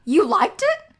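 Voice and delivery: loud, high-pitched astonished question; incredulous disbelief. Real, not acted. Co-host stunned that friend liked the shirt, incredulous. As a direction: pounce on it, pitch shooting up.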